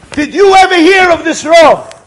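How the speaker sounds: high, very loud challenge; fervent, shouted question; impassioned demand. Acted. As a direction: cry out the question at full force. Survivor demands an answer.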